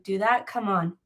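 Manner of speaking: ordinary talk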